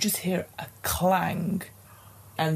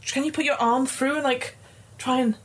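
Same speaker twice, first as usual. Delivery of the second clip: high pleading voice; 7 semitones higher than usual. Acted. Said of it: "she's like".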